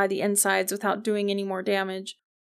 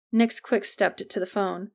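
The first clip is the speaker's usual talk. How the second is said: usual speaking voice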